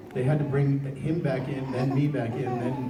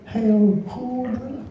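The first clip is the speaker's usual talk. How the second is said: higher, slow and drawn out; slow put-on cartoon voice; 9 semitones higher, 3 dB louder, much slower than usual. Shown as a demonstration. Voice actor giving fans the line in character. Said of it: "performing the line on request".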